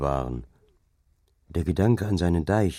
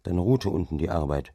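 usual speaking voice

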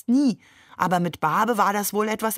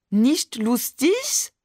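slow, higher mock French accent; exaggerated French accent; 5 semitones higher, much slower than usual. A narrator's reading. Barbe aping Maman teasingly, exaggerated French accent. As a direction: mimic a French accent, drawing it out upward. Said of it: "ahmte Barbe übertrieben Mamans französischen Akzent nach".

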